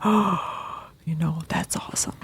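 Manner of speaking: breathless hushed wonder; awed whisper. Retold or imitated. Student seeing the hidden structure, awed whisper. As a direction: whisper it like a sudden discovery.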